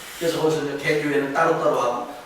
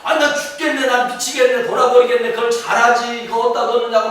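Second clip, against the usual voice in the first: higher, louder annoyed complaining; irritated outburst; 6 semitones higher, 5 dB louder than usual. Retold or imitated. Pastor retelling his own angry grumbling at the airport, higher and louder. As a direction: vent the frustration at the airport. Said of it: "나 더 짜증나는 거야".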